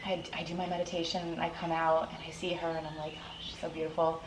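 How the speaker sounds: quiet tender aside; soft memory. Retold or imitated. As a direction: say it under your breath, moved.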